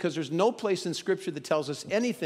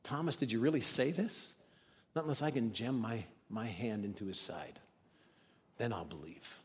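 softer and lower down to a hush; quiet rebuke fading; 5 semitones lower, 9 dB quieter than usual. Retold or imitated. Jesus quietly confronting doubting Thomas, fading. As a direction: let it sink to a murmur. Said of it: "And then he gets like".

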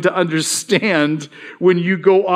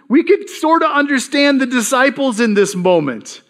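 ordinary delivery

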